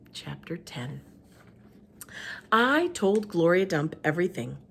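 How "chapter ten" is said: whisper. A narrator's reading. Chapter heading whispered.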